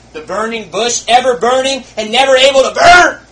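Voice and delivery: shouted high and loud; preaching shout. Real, not acted. Preacher shouting about endless burning. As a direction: burst out over the fire.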